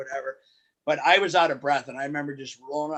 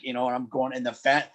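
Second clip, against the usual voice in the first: usual speaking voice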